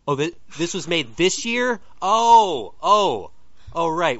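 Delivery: loud, high, startled; shocked realization. Real, not acted. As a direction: caught off guard, blurt it out. Realizes movie is new.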